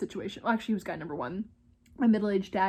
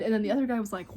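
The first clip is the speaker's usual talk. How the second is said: usual speaking voice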